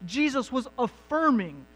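high, loud impassioned preaching; full-force preaching. Acted. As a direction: lift it up and drive each question home to the congregation. Preaching rises to a peak.

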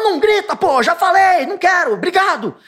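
hammed-up angry snap at the wife; irritated shout. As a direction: yell it at her, fed up and rushed. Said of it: "Você fala".

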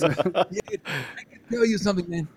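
usual speaking voice

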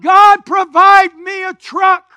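slow, loud cry to God; pleading shout heavenward; shouted prayer. Retold or imitated. Retelling his shouted prayer for truck.

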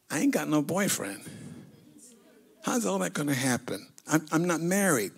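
quiet, wondering inner voice; softly voiced confused teenage girl; Mary's private thoughts. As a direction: murmur it like a girl thinking to herself.

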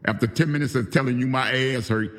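furious telling-off of a friend who betrayed him; angry rant. Real, not acted. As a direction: tear into her, voice up and pushing. Angry rant aimed at Holly.

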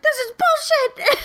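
high whining brother complaint; whiny brother. Retold or imitated. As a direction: squeal it like a sore loser. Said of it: "He's like".

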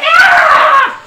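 high, loud panicked screech; goblin screech. Acted. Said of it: "he's kinda screeching as he goes in there".

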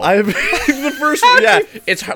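high, laughing through words. Real, not acted. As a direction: talk while cracking up. Still laughing about Tetris two.

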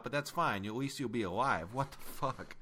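ordinary talk, nothing marked

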